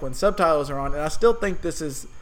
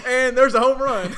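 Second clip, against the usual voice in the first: mock announcer call; 7 semitones higher, 6 dB louder than usual. Mock announcer call interrupting, laughing.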